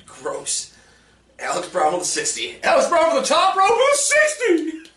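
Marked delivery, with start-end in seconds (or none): 2.6-4.9 much higher, louder hype call; wrestling announcer. Pretend. Announcing a friend's score like a wrestling call, hyped. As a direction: bellow it like a ring announcer.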